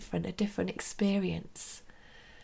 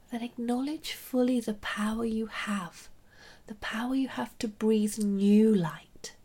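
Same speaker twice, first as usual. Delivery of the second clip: soft whispered meditation guidance; right in your ear; 4 dB quieter than usual. Acted.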